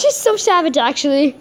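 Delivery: ordinary delivery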